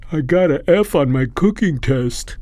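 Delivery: pitiful pretend whimper. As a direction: whine it like a cowering plea. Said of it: "he whimpered".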